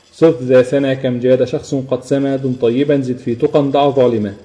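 slow, measured mnemonic verse; unhurried classical Arabic poem line; verse recitation. Shown as a demonstration. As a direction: recite it word by word at a slow pace.

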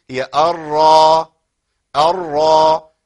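الراء drawn out, thick, lips rounded forward; over-thicken الراء slowly; overdone heavy ra. Shown as a demonstration. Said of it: "أبالغ في تفخيمها وأمط الشفتين". (تفخيم مبالغ فيه للراء)

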